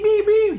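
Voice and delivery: very high-pitched honking beeps; imitated car horns. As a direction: beep like horns blaring at her.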